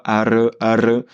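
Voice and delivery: "Ar, ar" with a trilled r; rolled r. Shown as a demonstration. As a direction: roll the r in "ar". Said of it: "entraîne-toi".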